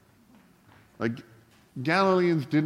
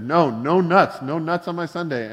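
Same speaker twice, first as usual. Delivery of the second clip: louder, higher, emphatic refusal; retold exasperated insistence; 5 semitones higher, 6 dB louder than usual. Customer to Dairy Queen worker over unwanted nuts, exasperated insistence. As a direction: push back insistently on a botched order.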